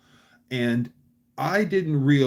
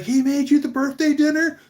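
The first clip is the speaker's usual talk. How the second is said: high, loud mock disbelief; astonished; 13 semitones higher, 5 dB louder than usual. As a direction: imitate an amazed boss, pitch shooting up. Boss's astonished reaction, retold.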